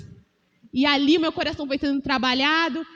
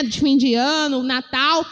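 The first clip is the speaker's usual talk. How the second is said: normal talking voice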